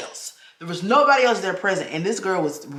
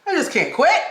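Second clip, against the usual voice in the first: exasperated protest; 10 semitones higher, 4 dB louder than usual. Retold or imitated. Exasperated reply to husband.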